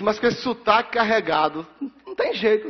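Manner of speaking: animated, emphatic retort; worked-up reply. Retold or imitated. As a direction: push back with rising energy. Retorting about his own accent.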